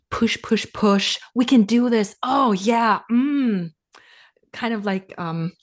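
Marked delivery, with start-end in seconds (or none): 0.1-3.7 imitating her old self, pumped up, a little higher and louder; hammed-up push-push self-talk; intense ecstatic hype. Recalling her burnout-era self cheering herself on, excited. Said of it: "really intense, almost ec-, ecstatic".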